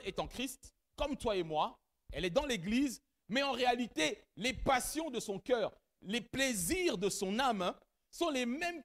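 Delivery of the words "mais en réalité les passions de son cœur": ordinary delivery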